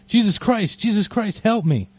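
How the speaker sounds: high, loud panicked cry for help; man screaming as the dog mauls him; terrified scream. Acted. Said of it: "dog attacking the man".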